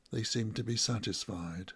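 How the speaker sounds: usual speaking voice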